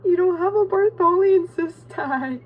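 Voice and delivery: high-pitched mock complaint; indignant retort. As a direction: snap back at the app in a high voice. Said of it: "And I was like".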